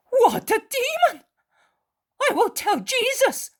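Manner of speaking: very high, loud indignant exclamation; exclaiming. A narrator's reading. Mary of Alphaeus outraged at Judas. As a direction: burst out in outrage. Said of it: "exclaims Mary of Alphaeus".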